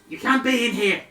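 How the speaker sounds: high goblin voice, scolding. Acted. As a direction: shoo them out as a goblin. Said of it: "I've gotta do my goblin voice again".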